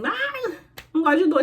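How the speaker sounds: high-pitched mocking whine; whiny wimp imitation; mock whining. Mocking someone who is squeamish about pain.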